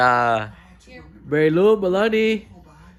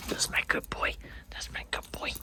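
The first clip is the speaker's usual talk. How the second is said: very soft, voiceless whisper; whispered affection; 15 dB quieter than usual. Real, not acted. Owner greeting his dog Taco, affectionate whisper.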